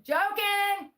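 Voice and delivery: high, loud, drawn-out call; exclaimed at a high pitch to clear the air; emphatic disclaimer. Real, not acted. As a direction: sing out the disclaimer loudly, way up high. Loud repeat of a joke disclaimer.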